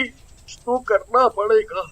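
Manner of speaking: low, worried thinking aloud; pondering. Acted. Chilli thinking before dozing off. (सोच में डूबा, धीमी आवाज़)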